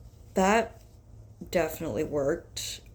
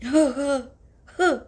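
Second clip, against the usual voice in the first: laughing; 10 semitones higher, 11 dB louder than usual. Real, not acted. Laughs after sneeze.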